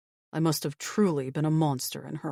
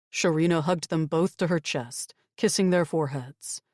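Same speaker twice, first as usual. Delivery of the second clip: ordinary delivery, nothing marked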